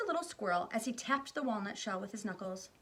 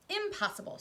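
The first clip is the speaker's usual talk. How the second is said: airy refusal; 12 semitones higher, 3 dB louder than usual. Acted. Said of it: "Wonka refusing cheerfully".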